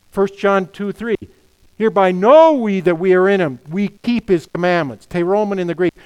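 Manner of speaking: loud, emphatic pulpit delivery; impassioned preaching. Real, not acted. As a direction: drive each point home with force. Preaches at full force.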